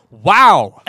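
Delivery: big, loud mock-awed wow; starstruck awe. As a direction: boom it out, dumbstruck by your hero. Retold starstruck greeting.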